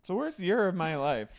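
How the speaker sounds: high dramatic whine; a hammed-up drunk lament; imitating a castmate's wail; mock Brandy. Voicing Brandy.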